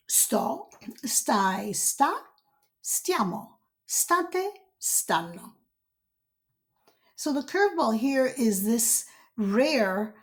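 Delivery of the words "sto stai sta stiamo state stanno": each form of stare said clearly, Sto almost whispered; Italian conjugation demo. Shown as a demonstration. Teacher modelling stare forms for students. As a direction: present each form for repetition.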